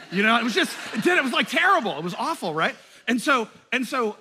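loud, high, laughing outburst; laughing shout. Real, not acted. Preacher recalling failed gratitude alarms, laughing shout.

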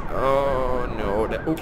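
ordinary delivery

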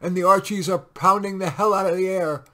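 loud urgent soldier. A narrator's reading. Sergeant warning captain in the dugout, loud. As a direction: push it over the shelling.

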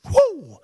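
a high, sudden whoop of joy; joyful whoop. Real, not acted. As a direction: let out an exultant preacher's whoop. Preacher exults mid-sermon.

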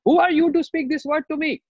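high, indignant challenge; angry outburst. Acted. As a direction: snap back as an offended sage. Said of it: "not angry mood".

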